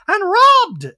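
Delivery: high, loud interjection in a mock voice; Dorit. Dorit interjects while Garcelle speaks, high loud impression. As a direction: cut in with a high, hammed-up cry. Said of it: "Dorit interjects".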